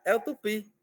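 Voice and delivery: ordinary talk, nothing marked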